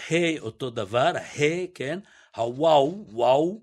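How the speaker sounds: ordinary talk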